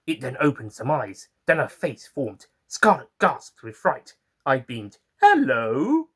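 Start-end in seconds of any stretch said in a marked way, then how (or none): 5.2-6.1 beaming hello. Acted. Doctor beams at plant.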